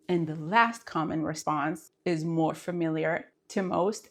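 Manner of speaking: ordinary delivery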